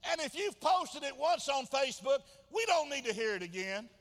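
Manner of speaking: high, loud, driving sermon delivery; full-force preaching. Real, not acted. Preacher challenging those who keep asking, full-force preaching. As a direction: hammer the questions home at the top of your voice.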